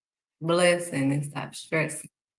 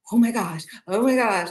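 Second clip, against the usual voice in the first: higher, gushing mock excitement; beyond excited; 4 semitones higher than usual. Believer shown something by God, beyond excited. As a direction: act out gushing at big news. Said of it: "I'm beyond excited".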